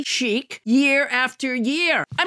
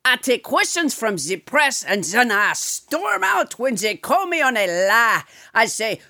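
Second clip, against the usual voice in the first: a lightly put-on French accent as Trump; hammed-up French-accented Trump bit; French Trump impression. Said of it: "it is a French President Trump".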